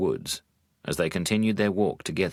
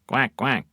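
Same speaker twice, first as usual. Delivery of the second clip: duck quack; 5 semitones higher than usual. Acted. Duck sound in the story.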